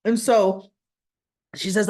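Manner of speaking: ordinary talk, nothing marked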